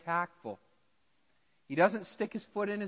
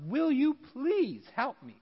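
pleading eunuch; 8 semitones higher, slower than usual. Retold or imitated. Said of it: "He's like".

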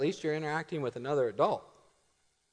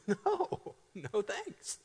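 laughing refusal; 4 semitones higher, 5 dB quieter, much slower than usual. Retold or imitated. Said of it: "it's like".

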